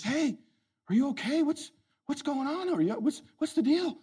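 put-on high, puzzled old man voice; confused father-in-law. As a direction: sound baffled and half asleep.

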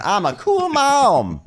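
high, drawn-out boast; hammed-up mom insisting she is cool; mock cool mom. Mocking self-declared cool mom.

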